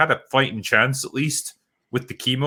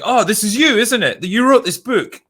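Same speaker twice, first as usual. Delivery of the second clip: higher, louder starstruck fan voice; eager fan; 9 semitones higher, 5 dB louder than usual. Acted. Fan recognising the author in public, higher and gushing. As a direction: gush at her like a fan who's spotted her. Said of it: "he's like".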